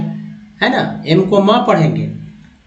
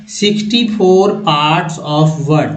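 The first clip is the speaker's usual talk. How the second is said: Sixty four parts of word spaced out slowly; slow it down to stress the title; deliberate, slow title; slow emphasis; much slower than usual. Shown as a demonstration. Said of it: "सबसे important बात है".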